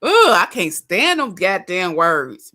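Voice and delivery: loud, drawn-out exasperation; exasperated disgust. Real, not acted. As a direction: groan it out, loud and fed up. Host disgusted by clip's "yes sir.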